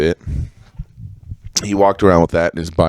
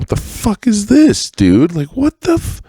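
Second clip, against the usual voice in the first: much higher, squeaky incredulous yelp; shocked disbelief; 14 semitones higher than usual. Retold or imitated. A teen finding a strange book in the backpack, shocked. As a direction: act stunned at what you just found. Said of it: "I was like".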